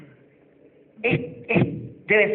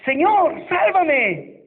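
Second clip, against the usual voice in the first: desperate cry; 6 semitones higher, much slower than usual. Acted. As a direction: cry out as if sinking. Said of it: "Entonces gritó".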